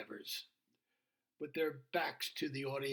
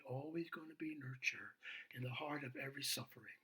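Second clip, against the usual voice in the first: hushed tender; 7 dB quieter than usual. Real, not acted. Preacher's moving point.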